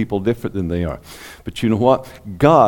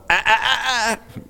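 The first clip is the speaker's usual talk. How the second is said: high scolding "ah ah ah"; warning ah ah ah; 12 semitones higher than usual. Retold or imitated. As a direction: warn like stopping a dog. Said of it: "the Holy Spirit's going".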